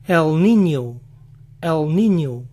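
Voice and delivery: niño as ninyo, ñ turned into ni; niño pronounced nee-nyo. Shown as a demonstration. Showing how learners swap the ñ, niño said as ninyo. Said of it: "Pronuncian la ñ como ni".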